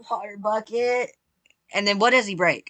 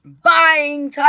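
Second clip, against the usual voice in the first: loud, higher announcer-like call; announcing call; 5 semitones higher, 7 dB louder than usual. Real, not acted. Streamer announces shopping time to viewers, loud announcing call.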